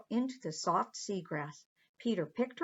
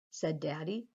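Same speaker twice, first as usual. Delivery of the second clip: usual speaking voice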